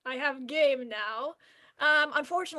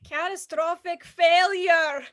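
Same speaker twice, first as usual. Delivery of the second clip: louder, higher robot crying out in despair; malfunction wail; 6 semitones higher, 10 dB louder than usual. Acted. Computer crying after the wrong module is plugged in, wailing.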